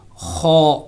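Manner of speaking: خو said thick and heavy from the upper throat; heavy kho. Shown as a demonstration. Teacher showing students how to say خو heavily. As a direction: give خو a full, heavy throaty sound. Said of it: "اس کو موٹا کر کے پڑھا جائے گا".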